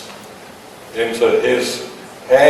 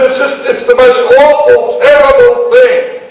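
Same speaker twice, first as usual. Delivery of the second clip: mock wailing; 9 semitones higher, 3 dB louder than usual. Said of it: "the attendant is weeping".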